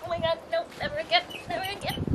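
high, fast breathless gasps; shocked cold shriek. Real, not acted. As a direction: gasp it out after hitting icy snow. After flopping into the snow.

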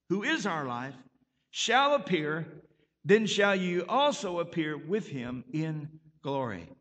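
ordinary delivery

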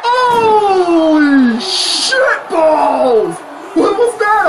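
slow drawn-out shocked yell; stunned shout. Real, not acted. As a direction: stretch it out in disbelief. Stunned by a big splash.

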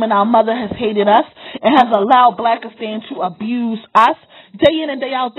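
usual speaking voice

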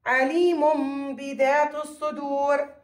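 عليم بذات الصدور slowly, with a held m before the ب; measured recitation of عليم بذات الصدور, lingering on the m; iqlab pronunciation. Shown as a demonstration. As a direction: stretch the tanween of عليم into a hummed m. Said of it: "تحول التنوين إلى ميم".

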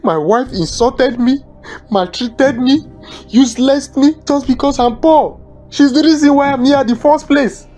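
heated, resentful blaming; bitter accusation. Acted. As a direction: build into an aggrieved outburst against the wife. Accuses wife, offers her for sacrifice.

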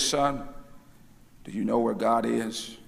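mock-solemn low, soft question to a boy; lightly voiced grave minister, low and quiet; serious preacher. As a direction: put on a serious, hushed voice. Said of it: "in his most serious voice".

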